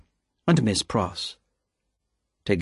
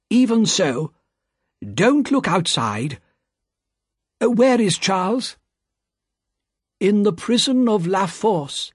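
higher, earnest, firm but gentle with Lucy; worried old banker; 7 semitones higher than usual. A narrator's reading. Mister Lorry sends Lucy away to wait, worried but firm. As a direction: soothe her, then take charge.

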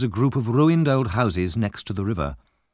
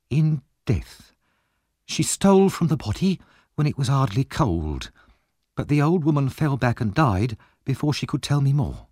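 higher-voiced shrewd wife bargaining; Mrs Bumble; 6 semitones higher than usual. A narrator's reading. Wife bargains with Monks for money, higher-pitched shrewd voice. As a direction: push for payment in a higher wife's voice.

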